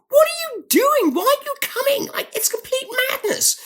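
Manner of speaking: high squeaky voice mimicking frantic friends; alarmed friends. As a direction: pitch way up, playing panicked callers.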